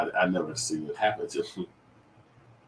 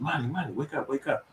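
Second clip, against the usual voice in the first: desperate plea; 8 semitones higher, 5 dB quieter than usual. Retold or imitated. Shaking the fainted son.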